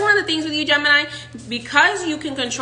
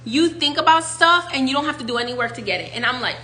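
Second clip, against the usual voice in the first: ordinary talk, nothing marked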